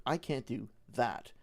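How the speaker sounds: "that" stressed, set apart at the end; slow, with emphasis on "that". Shown as a demonstration. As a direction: hold off, then land on "that". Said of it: "I put an emphasis on a different word".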